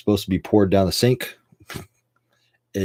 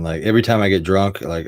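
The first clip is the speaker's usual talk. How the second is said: ordinary talk, nothing marked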